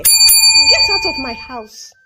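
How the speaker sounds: loud, slow, furious order. Acted. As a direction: throw her out with fury. Madam fires the maid.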